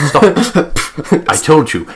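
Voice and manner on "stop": mock stop. Scolding own happiness.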